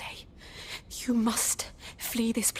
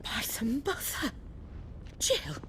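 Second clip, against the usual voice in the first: shocked whisper; 5 dB quieter than usual. Acted. Shocked at seeing Jill again.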